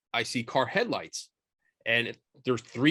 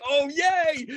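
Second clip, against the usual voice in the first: high-pitched giddy little cheer; retold squeal of delight, much higher; giddy excitement; 4 dB louder than usual. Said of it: "we're all giddy".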